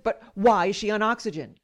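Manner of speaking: imitated insisting. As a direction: press the point like an impatient doctor. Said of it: "he insisted".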